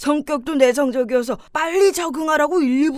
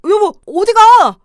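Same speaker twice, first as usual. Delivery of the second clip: calling out; 8 semitones higher, 10 dB louder, slower than usual. Acted. Calling out to husband leaving.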